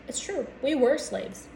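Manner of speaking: ordinary talk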